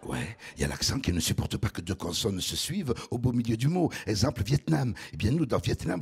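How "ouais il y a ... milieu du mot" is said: deep velvety southern voice. Pretend. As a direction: put on a deep velvet voice, like a charmer. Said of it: "Voix grave, veloutée". (voix grave et veloutée, ton chaleureux)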